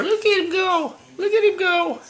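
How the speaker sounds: high excited voice; excited pet talk. Real, not acted. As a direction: cheer the climbing kitten on.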